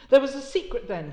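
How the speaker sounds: Lydgate's vehement outburst. Acted. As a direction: press the words hard. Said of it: "even vehemently".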